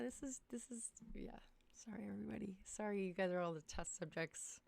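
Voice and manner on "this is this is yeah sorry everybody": whisper. Real, not acted. Nervous aside during stream test.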